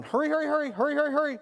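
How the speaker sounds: high, insistent rushing dad voice. Retold or imitated. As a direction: push the child along, impatient. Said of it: "And I'm going".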